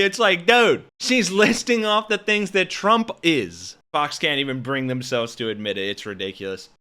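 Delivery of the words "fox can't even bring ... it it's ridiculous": ordinary talk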